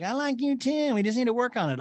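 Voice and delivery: high, sugary imitation; mocking a cast member's fake sincerity; mock Erica. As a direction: coo it up high and sweet. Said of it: "every time Erica says shit like".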